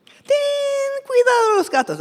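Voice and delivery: high, loud nagging warning; loud, high parental warning; parents warning. Acted. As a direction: sing out the warning to the kids.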